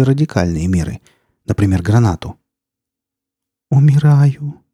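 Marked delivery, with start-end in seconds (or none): 3.7-4.6 put-on high, plaintive voice of a wounded girl; weak voice. A narrator's reading. Injured girl announcing her death to the forester, in a weak voice. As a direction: pitch it up, faint-hearted and suffering. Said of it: "слабым голосом".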